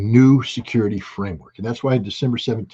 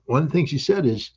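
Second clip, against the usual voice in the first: normal talking voice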